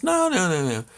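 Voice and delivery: high, insistent repeated no, imitating the wife; urgent no. Wife refusing to let him go in, high insistent voice.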